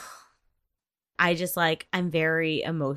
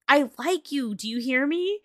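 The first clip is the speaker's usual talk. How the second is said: pleading confession; 8 semitones higher, 4 dB louder than usual. Retold or imitated. Confession in drama scene.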